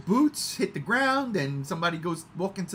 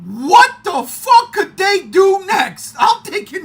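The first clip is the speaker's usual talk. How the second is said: loud, high and slow; shouted wonder; excited shout; 11 semitones higher, 13 dB louder, slower than usual. Real, not acted. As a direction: bellow it in hype. Hyped retelling of surprises.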